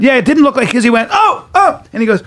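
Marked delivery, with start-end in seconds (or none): 1.1-1.8 high, short cries of "oh"; high-pitched mock spasm cries; imitated fit noises. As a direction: mimic someone seized by an episode. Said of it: "having some kind of an episode".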